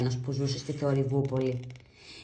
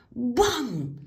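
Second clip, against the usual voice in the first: loud, high mock bang; door slam; 7 semitones higher, 4 dB louder than usual. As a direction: punch it out like a slammed door. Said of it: "τις βροντούσαν με δύναμη". (δυνατό «μπαμ» σαν πόρτα που βροντάει)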